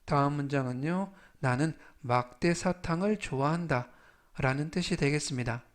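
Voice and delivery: usual speaking voice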